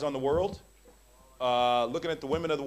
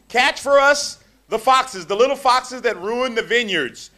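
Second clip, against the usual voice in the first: scripture reading; 4 semitones higher, 9 dB louder, slower than usual. Acted. Reading scripture aloud in a sermon.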